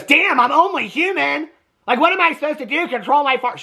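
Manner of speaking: hammed-up indignant comeback; mock indignant protest. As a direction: play up an exasperated self-defence. Retold indignant comeback to a scolding.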